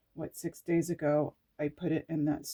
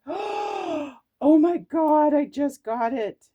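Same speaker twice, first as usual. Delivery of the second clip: sudden awe; 9 semitones higher, 9 dB louder, slower than usual. Real, not acted. Sudden spiritual realization.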